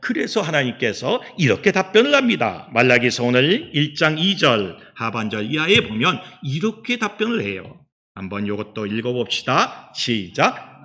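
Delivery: usual speaking voice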